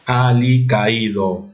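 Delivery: Alicaído slowly split as a-li-ca-Í-do; stressed í, separated. Shown as a demonstration. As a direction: sound out Alicaído, the í stressed and apart from the a. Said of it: "La tilde está en la I". (Alicaído lento, por sílabas: a-li-ca-Í-do)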